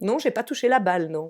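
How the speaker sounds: usual speaking voice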